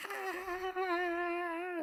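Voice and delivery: high gleeful whoop. Retold or imitated. Joyful flying scream.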